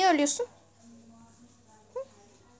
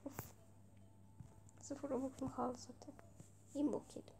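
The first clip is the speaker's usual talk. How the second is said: whispered self-scold; 13 dB quieter than usual. Real, not acted. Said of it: "whispers to himself".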